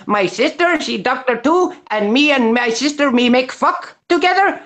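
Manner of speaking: high, put-on Arabic accent; hammed-up mock Arabic accent. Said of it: "I'll give you a little one".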